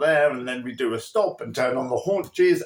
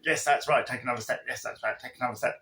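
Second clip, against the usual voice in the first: fading murmur; 4 semitones lower, 6 dB quieter, much faster than usual. Shown as a demonstration. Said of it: "you don't have to be going".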